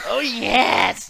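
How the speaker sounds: high, loud delighted monster; gleeful monster voice. Acted. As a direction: savor the smell like a gleeful monster. Voicing the Creeper smelling someone.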